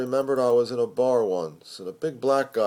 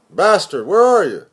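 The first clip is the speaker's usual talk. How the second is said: high, loud angry phone yell; loud rough greeting over the phone; shouting editor; 9 semitones higher, 11 dB louder than usual. Acted. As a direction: bark it down the line.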